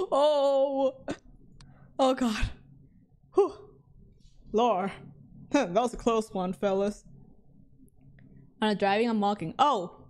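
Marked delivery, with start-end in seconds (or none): none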